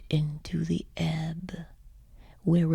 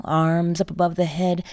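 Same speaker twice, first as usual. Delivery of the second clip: usual speaking voice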